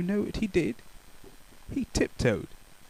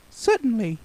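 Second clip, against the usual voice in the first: much higher cheerful reply; pleased baker; 10 semitones higher, 4 dB louder than usual. Acted. Baker answering politely, bright and high.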